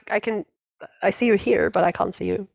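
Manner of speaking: normal talking voice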